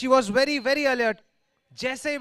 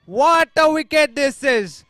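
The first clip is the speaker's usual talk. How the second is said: excited wicket call; 3 semitones higher, 9 dB louder than usual. Real, not acted. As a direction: stretch out "What a wicket" loudly. Commentator celebrates wicket. (Wicket पर ज़ोरदार जश्न)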